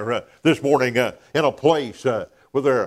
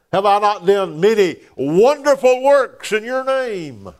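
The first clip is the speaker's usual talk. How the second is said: higher, louder pleading protest; preacher voicing sinners pleading their good works, higher and louder; 8 semitones higher, 5 dB louder than usual. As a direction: play the indignant sinner begging.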